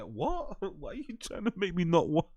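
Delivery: high-pitched, laughing disbelief; incredulous mock reply. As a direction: laugh in baffled protest at the warning. Mocking other parents' gloomy warnings.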